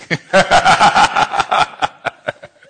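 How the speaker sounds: loud high burst of laughter; big laugh. Real, not acted. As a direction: laugh out hard at your own joke. Laughs at own joke.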